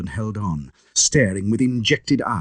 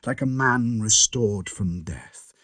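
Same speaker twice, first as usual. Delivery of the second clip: plain reading voice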